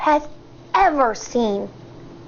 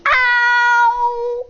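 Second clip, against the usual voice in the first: mild yell; 6 semitones higher than usual. A narrator's reading. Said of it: "yells Schubert".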